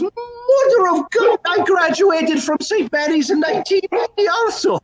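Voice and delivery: very high, astonished Irishman; Irish brogue. Acted. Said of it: "a bit of a brogue".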